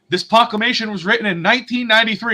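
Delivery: lightly hammed-up announcement; mock proclamation. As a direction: read it out like a self-important herald. Retelling werewolves reading a decree.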